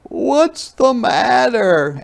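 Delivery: a mother noticing tears; high concerned mother; Mama asking. Acted. Mama voiced.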